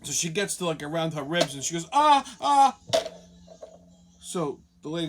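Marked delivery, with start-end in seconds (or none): 1.9-2.8 mock yelps of pain. Mother yelps as ribs touched.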